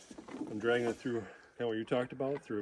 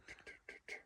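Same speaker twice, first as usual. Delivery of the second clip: very soft voiceless ticking taps; imitated animal footsteps; 22 dB quieter, faster than usual. Hunter imitating what came out of the swamp, hushed clicks. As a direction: tap out faint, toneless mouth clicks. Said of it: "I hear".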